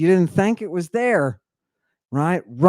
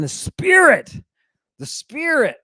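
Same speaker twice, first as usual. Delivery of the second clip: dramatic emphasis; 11 semitones higher, 4 dB louder than usual. Acted. Dramatic retelling of Ezekiel.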